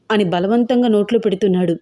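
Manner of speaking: usual speaking voice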